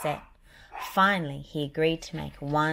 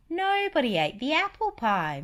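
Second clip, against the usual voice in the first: high, louder cartoon mouse girl; Minnie voice; 10 semitones higher, 6 dB louder than usual. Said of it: "Minnie said".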